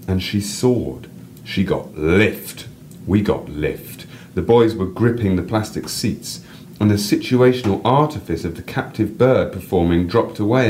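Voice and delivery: reading a personal story aloud, lively. A narrator's reading. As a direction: read your piece to the listener with feeling. Reads own story aloud.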